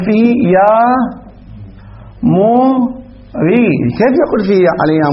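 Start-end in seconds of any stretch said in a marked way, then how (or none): 0.6-3.7 slow teaching demo. Shown as a demonstration. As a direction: draw out يا موري very slowly, stretching موري. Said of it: "يعني نجعله منادى". (نطق بطيء ممدود للتعليم)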